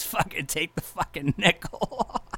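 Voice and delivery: usual speaking voice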